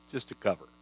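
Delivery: hushed, reflective repeat; quiet echo. Real, not acted. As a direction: let the words sink in, almost under the breath. Echoing the quote in awe.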